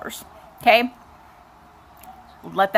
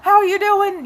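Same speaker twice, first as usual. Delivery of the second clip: high, bubbly, fast gushing greeting; sing-song high fake-friendly welcome; chirpy lab girls; 10 semitones higher than usual. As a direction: gush over her like an overly cheerful nurse. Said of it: "they're all like".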